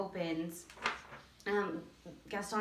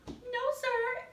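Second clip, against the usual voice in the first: put-on sassy silly girl. Shown as a demonstration. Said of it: "hands on her hips".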